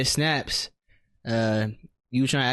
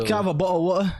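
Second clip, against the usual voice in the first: higher, put-on British accent with dropped sounds; mock London accent; 5 semitones higher, faster than usual. Friend keeps up the London joke, mock British accent. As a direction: play a Brit ordering a drink. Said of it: "over there to London".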